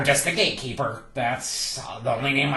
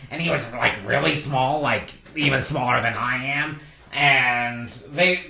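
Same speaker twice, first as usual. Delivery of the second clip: normal talking voice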